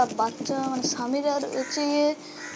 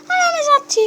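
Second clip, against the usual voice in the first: put-on much higher, louder girl's voice calling back; high girlish call; 14 semitones higher, 6 dB louder than usual. Samira answers her brother's call, high loud girl voice. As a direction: answer like a kid from the next room.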